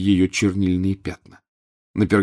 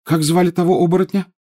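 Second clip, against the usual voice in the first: usual speaking voice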